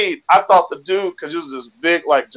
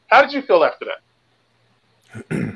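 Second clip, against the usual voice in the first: therapist calm; much slower than usual. Acted. Mock therapist asking gently.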